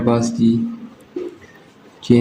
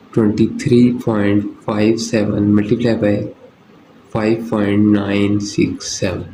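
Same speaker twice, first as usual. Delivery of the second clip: very slow, measured dictation; digit by digit; much slower than usual. Shown as a demonstration. Teacher dictating a sum to students, slowly digit by digit. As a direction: read the figures out one digit at a time, slowly. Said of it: "digits को पढ़ते हैं तो one by one पढ़ा करें".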